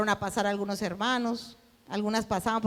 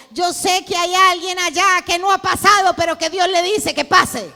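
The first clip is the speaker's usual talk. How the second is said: much louder, higher preacher calling out to the hall; pastor's altar call; 7 semitones higher, 11 dB louder than usual. Retold or imitated. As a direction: call the hidden sinner forward from the pulpit. (el pastor llama al altar, fuerte y agudo)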